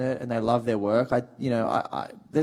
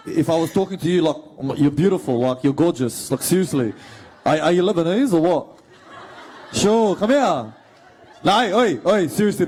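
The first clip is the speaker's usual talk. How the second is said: thick Punchbowl Lebanese-Australian accent, loud and punchy; 2 semitones higher, 4 dB louder than usual. Shown as a demonstration. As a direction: chat them up in a broad Punchbowl accent. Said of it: "Can you please do the Punch Bowl accent?".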